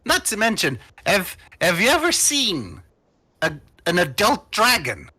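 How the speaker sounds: high, excitable sales pitch; cajoling gnome. Acted. Gnome explains to the party why they want creatures, excitable. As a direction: argue back eagerly, jumping high in pitch.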